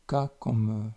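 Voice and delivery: ordinary delivery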